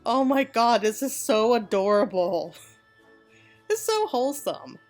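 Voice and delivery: ordinary talk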